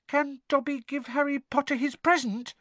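very high, timid elf voice; tentative squeak. A narrator's reading. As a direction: squeak a hesitant request. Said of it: "he squeaked tentatively".

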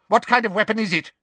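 quick, hard question; Umbridge's fast, harsh demand. A narrator's reading. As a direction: snap it out fast. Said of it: "said Umbridge harshly".

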